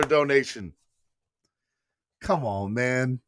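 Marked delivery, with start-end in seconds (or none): none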